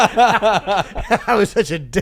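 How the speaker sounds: slow, laughing self-mockery. Real, not acted. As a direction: say it through laughter, drawn out. Laughing at own story.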